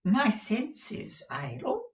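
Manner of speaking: very high, cooing endearment; doting Mr Mantalini. Acted. Husband soothes his scolding wife, high and doting.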